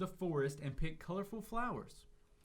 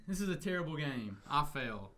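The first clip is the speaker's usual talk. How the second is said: ordinary delivery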